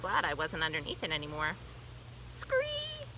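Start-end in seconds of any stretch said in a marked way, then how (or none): 2.3-3.1 very high, thin frog screech. A narrator's reading. Frog repeating his rescue cry, screeching. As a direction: squeal it out in a tiny high voice. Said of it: "Og repeated".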